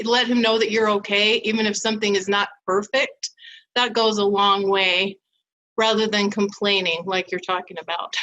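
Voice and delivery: ordinary talk, nothing marked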